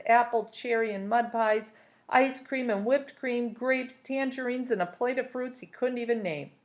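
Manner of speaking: ordinary delivery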